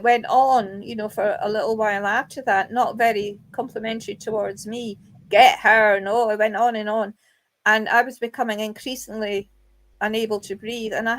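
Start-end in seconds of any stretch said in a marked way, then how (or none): 5.3-6.0 loud imitation of a spirit voice; forceful retold spirit command; loud ghostly recording. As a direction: imitate the EVP, punched out hard.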